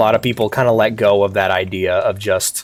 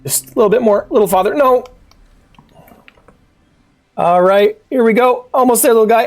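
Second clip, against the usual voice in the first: anxious high coaxing; 9 semitones higher than usual. Real, not acted. Coaxing the little character along.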